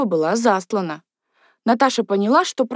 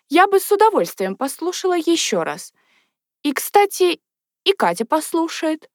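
higher-pitched, would-be casual suggestion; studied indifference; 6 semitones higher than usual. A narrator's reading. Natasha hints to her father, trying to sound indifferent. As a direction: float the idea as if it hardly matters. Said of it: "стараясь говорить самым безразличным тоном".

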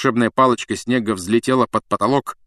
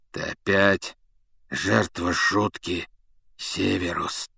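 hoarse; 5 dB quieter, slower than usual. A narrator's reading. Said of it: "прохрипел Блэк".

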